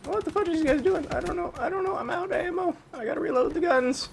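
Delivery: squeaky high-pitched put-on voice; silly high voice. Gamer mocking his own team in a squeaky voice. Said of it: "I gotta stop doing that voice".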